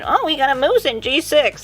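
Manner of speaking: high-pitched mimicry of a know-it-all commenter; mock objecting viewer. Imagined viewer objecting about the moose, mockingly high. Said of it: "I know somebody out there saying".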